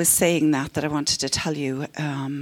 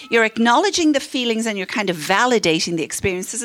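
ordinary talk, nothing marked

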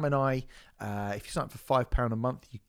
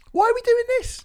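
squeaky exasperated; 11 dB louder than usual. Pretend. Squeaky self-mocking outburst.

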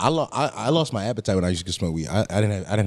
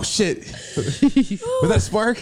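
mock alarm; 3 dB louder, much slower than usual. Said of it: "I'm like".

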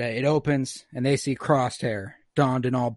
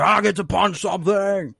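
overexcited, higher put-on shout; Wrecker; 7 semitones higher, 4 dB louder than usual. Brute thrilled to punch the wall, overexcited. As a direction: burst out like an eager brute. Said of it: "Wrecker gets overly excited".

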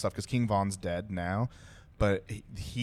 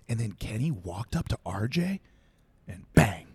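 Swamp Stories narrator impression; 2 semitones higher than usual. Showing how the YouTuber tells a killing, dramatic narration. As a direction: imitate a suspense narrator, pause before the punch. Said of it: "He'll go like".

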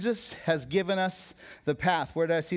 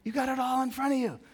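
high-pitched imitation of the crowd; mock clamoring voice; 10 semitones higher than usual. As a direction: mimic the world's pushy pitch. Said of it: "everyone's kinda yelling and whispering".

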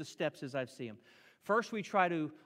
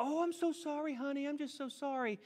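much higher, gushing, giving-in parent; caving parent; 9 semitones higher than usual. Acted. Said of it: "the parent who will just cave and go".

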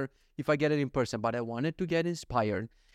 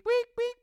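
high electronic beeps; mock beep beep noises; machine beeping. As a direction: imitate a checkout scanner. Imitating checkout beeping.

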